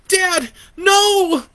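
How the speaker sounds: high loud anguished shout; horrified cry. A narrator's reading. Boy watching his father attacked, horrified scream. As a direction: scream as your father is torn.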